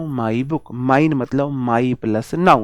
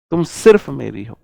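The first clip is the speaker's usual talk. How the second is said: ordinary delivery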